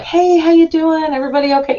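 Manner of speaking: high, bright and loud hello; overly cheery greeting. Retold or imitated. Walking into the pharmacy, greeting the tech with exaggerated cheer. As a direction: greet her brightly, extra chipper. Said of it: "And I walk in and I'm like".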